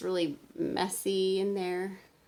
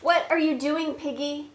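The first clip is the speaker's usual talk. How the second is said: louder, higher puzzled call to Piggy; Gerald puzzled; 5 semitones higher, 8 dB louder than usual. Acted. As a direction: ask Piggy what on earth she's up to. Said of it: "When I say the Gerald part".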